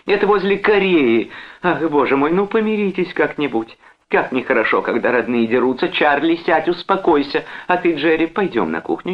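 high, fretful woman's voice; pleading persuasion. A narrator's reading. Wife pacifies husband and brother, pleading. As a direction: coax both men to calm down. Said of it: "уговаривала Джон".

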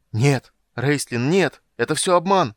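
loud, high-pitched cry of denial; shouted protest, voice leaping up; desperate cry. A narrator's reading. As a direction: cry out in protest, drawing out the first word. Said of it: "закричал он".

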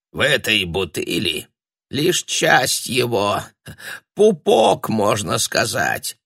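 put-on slow, trembling old sorcerer; quavering old voice. A narrator's reading. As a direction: creak it out like an ancient man.

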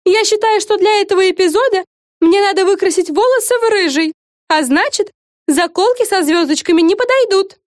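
high, loud, demanding; bossy diva. A narrator's reading. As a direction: make demands like a star. Fren demands. (капризная звезда)

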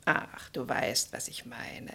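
slow, hushed whisper; knowing whisper. Acted. Julia hints knowingly to Edward, slow whisper. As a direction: let the hint hang in a whisper.

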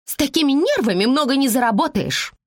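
high, contemptuous parting shot; scornful. A narrator's reading. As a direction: toss it back with disdain. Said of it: "презрительно бросила женщина".

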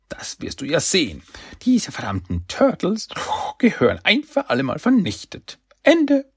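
hohe, verstellte Schurkenstimme, immer höher; hoch und zornig steigern bis zum lauten Schlusswort; Krang. A narrator's reading. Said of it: "fiel ihm Krang ins Wort". (wütendes Gehirn mit hoher Kunststimme)

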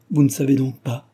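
ordinary delivery, nothing marked

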